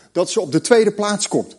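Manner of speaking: usual speaking voice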